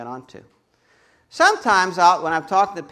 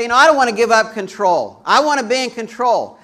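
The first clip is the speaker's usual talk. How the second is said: higher, louder stubborn voice; stubborn insistence; 6 semitones higher, 10 dB louder than usual. Retold or imitated. Churchgoer demanding control, higher and louder. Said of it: "People say".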